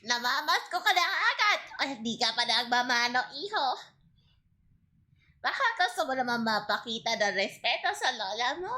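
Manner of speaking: nagging Filipino grandma. Acted. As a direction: scold like an old lola. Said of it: "you want me to be a grandma next".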